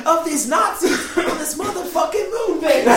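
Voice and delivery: high, loud shouting movie-hero rant; Samuel L. Jackson. Pretend. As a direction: shout it like a fed-up action hero. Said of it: "I really wish it was, like, Samuel L. Jackson".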